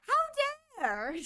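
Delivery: indignant squeal for laughs; squeaky mock outrage. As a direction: pitch up into a cartoon gasp.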